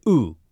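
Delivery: "oo" with rounded lips pushed forward; oo vowel. Shown as a demonstration. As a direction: hold a tight rounded "oo".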